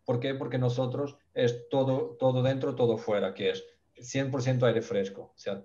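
usual speaking voice

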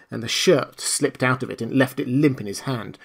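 usual speaking voice

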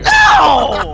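mock yell. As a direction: ham up a slow dramatic no. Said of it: "It's like".

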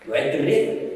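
usual speaking voice

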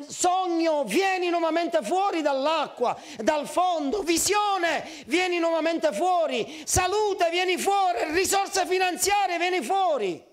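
high, commanding preacher's call; urgent high-pitched calling out; crying out. Acted. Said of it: "tu devi gridare al tuo miracolo".

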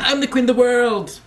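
mock queen. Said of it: "I'd be like".

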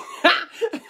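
short real laugh, rising; burst of laughter. Real, not acted. Agony aunt cracking up at a reader's letter, laughing.

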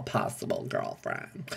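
breathy whispered aside. Real, not acted. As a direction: whisper it teasingly. Playful aside to fan.

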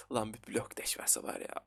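soft unvoiced aside; whispered wish. Real, not acted. Gamer whispering a wish for the Block Dash map. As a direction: whisper it as a secret hope.

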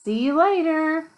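high, loud goodbye call; storybook call-out. A narrator's reading. Teacher reads the children's goodbye, high loud call. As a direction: call it out as if to a departing bus. Reading picture book aloud.

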